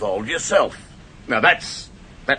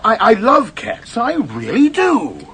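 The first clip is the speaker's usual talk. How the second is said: higher, louder, slower plea; pleading protest; 9 semitones higher, 6 dB louder than usual. Acted. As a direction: insist on your innocence. Man protests his guilt.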